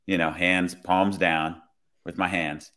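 ordinary delivery

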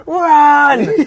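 sudden full-throated yell of Run; retold shout. Telling his mates about the attack, yelling at them to run. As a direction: bark the warning at your friends. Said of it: "so I'm just like".

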